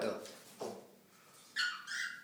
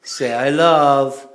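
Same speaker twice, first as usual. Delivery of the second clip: loud, emphatic teaching call; loud teaching prompt; 9 dB louder, slower than usual. Real, not acted. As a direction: drill the words for the bird.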